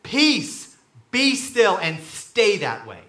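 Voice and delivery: firm, loud parental command; parent to child. Acted. Jesus to the storm like a parent, firm command. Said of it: "like a parent talking to a child".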